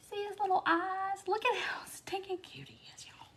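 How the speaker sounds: high, hushed coo over something tiny; cooing whisper. Real, not acted. Cooing over the little bird.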